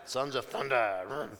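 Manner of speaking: mock biker-gang growl of a name; motorbike gang. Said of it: "It sounds like a motorbike gang".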